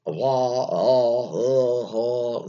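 drawn-out open vowels with no consonant endings; mock an accent by leaving every word hanging open; mock unfinished consonants. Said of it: "they didn't wanna finish, uh, hard consonants".

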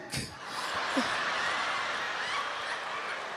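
high shrieking laugh. Real, not acted. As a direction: burst out laughing. Laughing at own punchline.

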